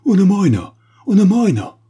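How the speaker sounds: put-on high, very loud shout in a Spanish accent; frightened Spanish-accented cry. A narrator's reading. Spanish grandee warning the uncomprehending guests, shouting with Spanish accent. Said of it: "le grand d'Espagne cria encore, avec son accent espagnol".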